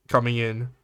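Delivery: normal talking voice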